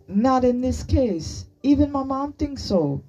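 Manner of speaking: high, emphatic comeback; retorting. A narrator's reading. Chi-Chi contradicting her friend about Sunny, retorting. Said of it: "Chi-Chi retorted".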